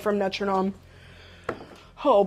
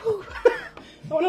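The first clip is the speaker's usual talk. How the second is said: ordinary delivery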